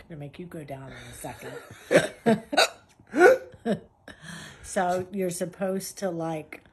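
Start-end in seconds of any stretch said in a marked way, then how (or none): 3.1-3.8 sudden high burst of laughter; loud laugh. Real, not acted. Laughs at the tease.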